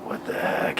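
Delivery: whispered dismay, acted out; hushed, soft voice of someone dreading company; aghast aside. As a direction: say it under the breath, appalled. Yankele aghast at seeing soldiers.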